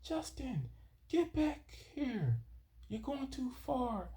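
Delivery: very high, slow, stretched-out call; teacher yelling. Retold or imitated. History teacher calling drowning boy back, high drawn-out mock yell. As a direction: imitate the history teacher hollering from the beach, drawn out. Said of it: "She was like".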